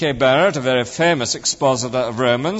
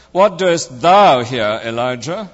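slow question, "thou" loud and drawn out; stress on THOU; 5 dB louder, slower than usual. Retold or imitated. Friend mimicking a preacher's emphasis, stress on "thou". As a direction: hit "thou" hard and stretch it. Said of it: "emphasizing each word in turn".

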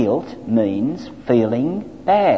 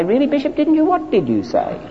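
much higher, feigned astonishment; hammed-up "really?"; mock surprise; 12 semitones higher than usual. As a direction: play innocent surprise at the bishop. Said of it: "I'd say".

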